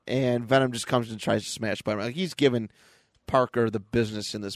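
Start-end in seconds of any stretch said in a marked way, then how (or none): none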